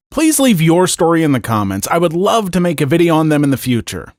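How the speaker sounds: loud, cheerful call to comment and like; outro announcement. Acted.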